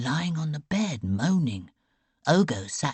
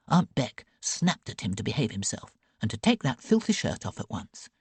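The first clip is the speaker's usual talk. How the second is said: plain reading voice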